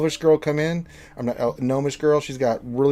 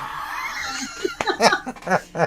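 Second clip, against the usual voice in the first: high, helpless real laugh; squealing laughter. Real, not acted. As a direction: burst out laughing, pitch shooting up. Pet runs off.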